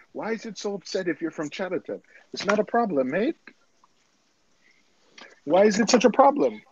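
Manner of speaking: high-pitched mock Chatterton protest; mock Chatterton accent. Teasing a friend from Chatterton, high mock local accent.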